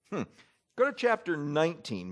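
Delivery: ordinary talk, nothing marked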